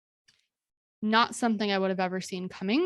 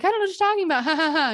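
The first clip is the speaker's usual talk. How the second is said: much higher, louder forced innocence; nervous fake laugh; 7 semitones higher, 4 dB louder, much faster than usual. To her attacker at the cliff edge, forced innocent laugh. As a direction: laugh off a threat you fear. Deflecting his threat with laugh.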